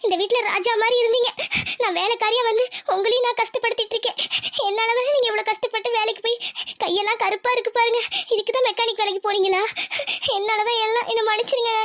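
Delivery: wife begging her husband's forgiveness, tearful; tearful apology. Acted. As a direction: blame yourself through tears. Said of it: "எதுக்கு அழுவுற இப்ப நீ?".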